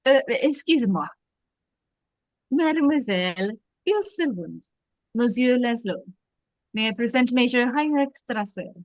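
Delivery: put-on lightly French-accented police captain. Captain introduces the major to the couple, French accent. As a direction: play Renault with a French accent. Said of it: "I think you do the accent".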